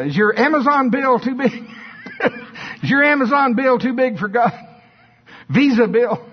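high, loud, building preacher's challenge; preaching at full force, pitch climbing. Acted. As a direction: shout it to the congregation, rising.

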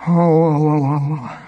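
mock yawn, bored. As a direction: fake a big lazy yawn. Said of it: "I'm yawning is what I'm doing here".

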